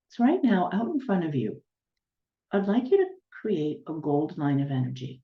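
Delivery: unhurried guiding voice, long silences between instructions; guided meditation. Real, not acted. Healer guiding listeners to ground down to the planet's center, guided meditation. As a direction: lead them step by step, letting each image settle.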